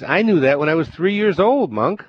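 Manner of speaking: the governor scoffs at the monk's simple answer; indignant. A narrator's reading. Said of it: "Irritated and annoyed".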